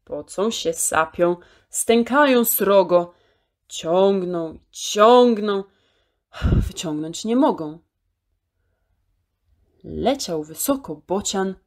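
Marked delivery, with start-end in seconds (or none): none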